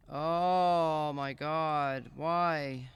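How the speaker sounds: long, very slow, loud "Oh my God", then a loud "why"; despairing cry. Real, not acted. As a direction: wail it out to the sky in real frustration. Frustrated streamer, game going wrong.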